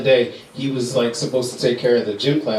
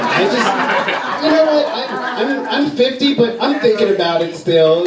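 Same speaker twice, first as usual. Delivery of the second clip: put-on older woman quoted; 8 semitones higher than usual. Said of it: "everybody I know is like".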